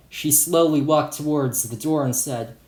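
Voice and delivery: plain reading voice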